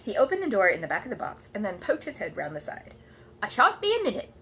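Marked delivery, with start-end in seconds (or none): 3.4-4.3 high, louder eager little bear. Acted. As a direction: chirp it cheerfully from behind the box. Paddington, cheerful bear voice.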